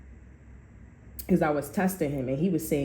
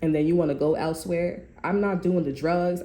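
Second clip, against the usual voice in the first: ordinary delivery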